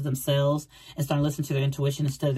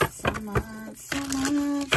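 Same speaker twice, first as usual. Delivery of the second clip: high, soft, sweet repeat; cooing thanks; 11 semitones higher, 3 dB quieter, much slower than usual. Real, not acted. Thanking a viewer warmly, in a high cooing voice. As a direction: melt a little as you say it.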